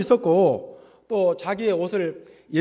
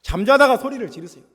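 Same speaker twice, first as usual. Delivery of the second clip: raised, emphatic exhortation; urging shout; 4 dB louder than usual. Shown as a demonstration. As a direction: urge them to cry out, punching the opening word. (힘주어 외치라고 권하듯)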